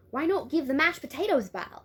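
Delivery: high, loud attempt at an Australian accent; hammed-up Australian girl. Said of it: "with an Australian accent".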